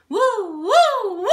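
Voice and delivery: very high loud siren woo. Shown as a demonstration. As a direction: go as high as you can, then slide down. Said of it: "Try to go as high as you can".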